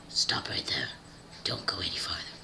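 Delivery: very deep, hushed male-character voice; low whispered warning. Acted. The guy orders the girl to stop, deep hushed voice. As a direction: halt her in a low, breathy growl.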